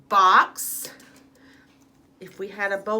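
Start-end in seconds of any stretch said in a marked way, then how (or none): 0.1-0.9 Box said much louder, punching the final x; stress on the X sound. Shown as a demonstration. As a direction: hammer the ending of Box. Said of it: "Fox has the X sound at the end".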